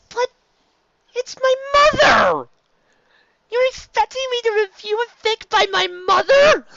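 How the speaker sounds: very high, loud, crying wail; wailing despair. Real, not acted. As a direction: howl it like it's unfair. Despair at reviewing mother's fic.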